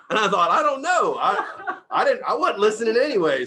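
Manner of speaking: laughing hard, high and loud. Real, not acted. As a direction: crack up telling the punchline. Laughing at his own story.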